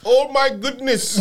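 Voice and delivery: mock Indian accent. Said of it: "why did it have to be in an Indian accent".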